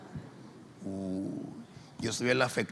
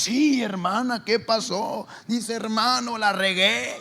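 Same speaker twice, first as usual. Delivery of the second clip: higher, louder pleading woman's voice, slow; distressed sister; 10 semitones higher, 5 dB louder than usual. Retold or imitated.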